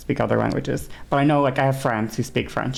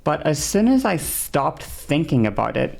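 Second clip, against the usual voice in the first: ordinary delivery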